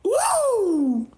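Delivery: high wrestler whoop; Ric Flair woo. Retold or imitated. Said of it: "Like Ric Flair".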